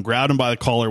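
normal talking voice

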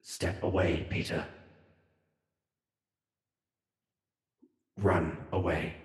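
twist the voice, leaving space before the last words. Acted. Twisted voice warning Peter to run, rough and distorted. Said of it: "this voice, this twisted voice say".

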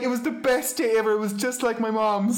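high, bubbly retold joy; gushing delight. Amy raving to Kevin about her brown bread, gushing. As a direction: bubble over like a homesick girl.

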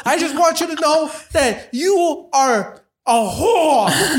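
high, slowed yell up at the building; unhinged street shouting, drawn out; retold yelling. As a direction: bellow the insult at the windows. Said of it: "and just yelling".

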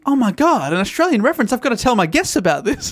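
gushing retold reaction; excited discovery. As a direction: relive the thrill of spotting it. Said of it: "I read that going".

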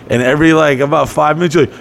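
ordinary delivery

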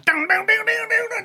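high mouthed guitar riff; guitar riff imitation. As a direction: mimic a lead guitar, high. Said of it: "then your man goes".

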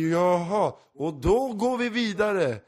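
high, loud and slow forced cheerfulness; mock awkward cheer. Panicking comic on stage plays it off with slow forced cheer. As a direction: push on brightly as if nothing happened, dragging it out.

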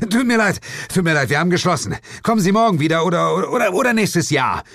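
fast, high, flustered brush-off; hurried apology. A narrator's reading. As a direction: rattle it off to get rid of them. Narrator hurriedly turns customers away.

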